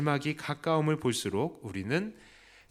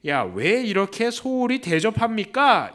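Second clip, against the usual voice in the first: loud, high acted grumble of a guest; raised-voice mock complaint; complaining; 7 semitones higher, 7 dB louder than usual. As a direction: protest like a slighted guest, mock indignant. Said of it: "이렇게 원망하는 게".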